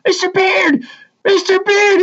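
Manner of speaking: high-pitched goofy holler; imitated yell. Former student yelling at teacher walking out at commencement, imitated yell. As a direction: call out like a goofball across a crowd. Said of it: "he's yelling".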